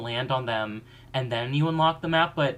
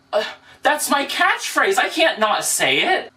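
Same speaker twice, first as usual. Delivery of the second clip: much higher, loud indignant whine; high whiny character voice; 9 semitones higher, 6 dB louder than usual. Acted. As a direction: protest like a bratty girl. Skit character defends catchphrase.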